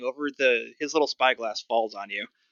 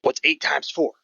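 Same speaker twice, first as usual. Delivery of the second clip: lightly voiced gruff goblin, pitched up; gruff old goblin; 4 semitones higher, 4 dB louder than usual. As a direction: put on a hint of a crusty old goblin ticket seller. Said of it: "Sort of this gruff old goblin".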